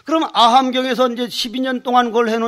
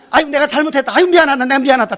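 higher, louder pleading "아이고" of a beaten man; exasperated apology; 4 semitones higher, 5 dB louder, faster than usual. Retold or imitated. Defeated Gyeongsang man begs the Chungcheong man to stop, loud. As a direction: plead loudly, half laughing at yourself.

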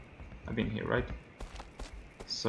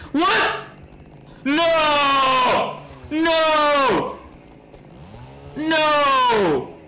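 high, drawn-out cries of panic; panicked shouting; 10 semitones higher, much slower than usual. Real, not acted. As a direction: scream in sudden fright. Frightened by the game.

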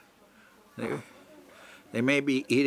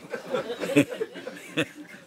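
high-pitched laugh; real burst of laughter up high; 13 semitones higher than usual. Real, not acted. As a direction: laugh at yourself. Laughs at own failed command.

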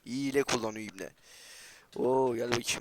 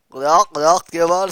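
mocking shout; 4 semitones higher, 13 dB louder, slower than usual. Real, not acted. Mocking player like a chicken.

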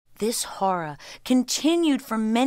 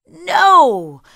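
loud, high cry of refusal; anguished cry; 8 semitones higher, 14 dB louder than usual. A narrator's reading. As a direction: cry out in protest at full voice. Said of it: "cried the vizier".

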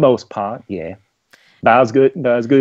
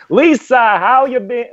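put-on southern accent; 10 semitones higher, 4 dB louder than usual. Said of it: "I'm back to my normal accent".

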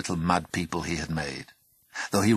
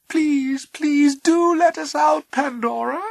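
pleading little voice; 8 dB louder, slower than usual. Acted.

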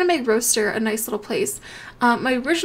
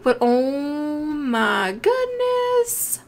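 awed; 2 semitones higher, much slower than usual. Real, not acted. Awed by ocelot.

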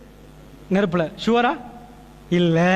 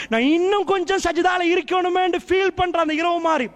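higher and higher, piling up the nights; a sermon climbing to a peak; rising fervent preaching; 5 semitones higher than usual. Acted. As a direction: preach it with mounting passion. Builds a fervent list of nights.

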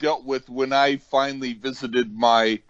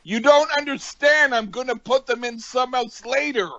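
much higher, whiny mock uncle; 10 semitones higher than usual. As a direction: squeak it out like a stubborn brat. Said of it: "He went".

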